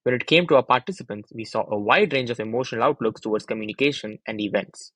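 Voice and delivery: ordinary talk, nothing marked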